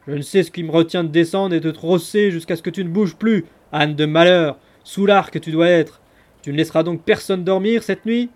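loud brutal roaring; ferocious threat. A narrator's reading. Bearded ruffian threatening Andreuccio from the window, ferocious roar. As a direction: threaten to thrash him. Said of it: "Plus féroce encore".

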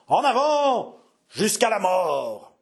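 high, ringing cry, slow on the end; rally the riders with a desperate cry; desperate battle cry. Acted. François cries out to his two thousand riders, desperate. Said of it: "d'une clameur éclatante et désespérée".

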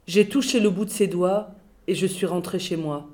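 plain reading voice

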